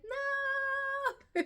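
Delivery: long, very high mock scream. Mother re-enacting the next contraction, high screamed refusal. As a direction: wail it out high and loud, hammed up.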